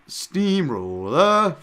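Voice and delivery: loud, high, very drawn-out nickname call; big mock ring introduction; hammed-up announcer. Announces fighter's nickname with relish.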